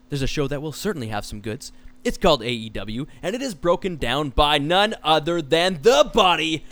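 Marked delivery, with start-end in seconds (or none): 3.2-6.6 booming wrestling ring announcer. Acted. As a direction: stretch the name out like a ring intro. Host announces guest with build.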